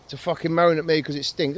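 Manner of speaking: normal talking voice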